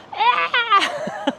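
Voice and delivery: high laughing scream; high mock scream, laughing. Boy going over the edge, high laughing scream. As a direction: let out a kid's shriek at the drop.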